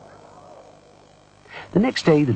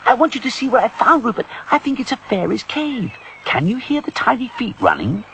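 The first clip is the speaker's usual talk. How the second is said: high-pitched eager character voice; high, lively storybook voice; Morwenna, excited; 3 dB louder than usual. Acted. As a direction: share a discovery with a friend. Morwenna excitedly shows her find.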